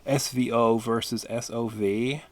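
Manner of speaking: put-on loud, over-the-top American accent. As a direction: clown American voice. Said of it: "going into this weird American accent".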